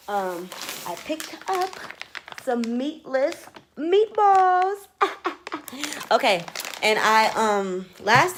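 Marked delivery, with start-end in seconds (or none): 0.1-4.9 slow build to a high, loud reveal; excited reveal. Real, not acted. Proud reveal of purchase. 4.9-6.1 high burst of laughter; laughing. Real, not acted. Laughs at own reveal.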